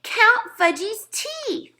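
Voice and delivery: put-on high, very slow toddler demand; little Fudge demanding. A narrator's reading. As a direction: drag it out like a pushy little boy. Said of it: "he said".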